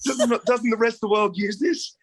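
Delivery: high, laughing, incredulous shout; can't believe the world doesn't say it; laughing disbelief. Real, not acted. Guest laughing at the host in disbelief. Amazed nobody knows Akadaka.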